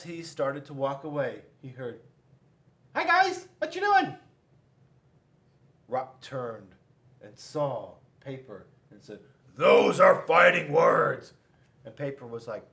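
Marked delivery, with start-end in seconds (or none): 2.9-4.2 much higher, louder friendly greeting; squeaky Paper voice. Acted. Paper greets Rock and Scissors, high chirpy voice. 9.6-11.3 loud, slow, threatening; loud challenge. Acted. Rock challenges Paper, loud menacing declaration.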